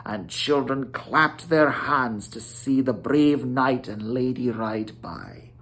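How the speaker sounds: plain reading voice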